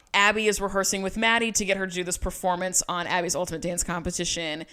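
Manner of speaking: ordinary talk, nothing marked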